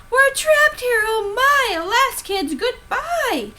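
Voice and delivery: high, loud, wailing half-sung panic; hammed-up despair on the island; panicked scream. Teacher stranded after bridge collapse, wailing in mock panic. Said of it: "started to scream".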